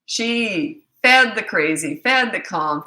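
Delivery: slow, weighty "fed" hammered each time; stress on fed. Real, not acted. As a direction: punch "fed" both times, slowly. Contrast with her own method.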